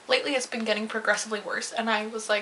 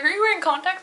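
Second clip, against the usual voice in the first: higher, louder mock alarm; imitating shocked piercer; 8 semitones higher, 4 dB louder than usual. Piercer gawks at her huge pupils, mock shocked. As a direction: play the startled piercer.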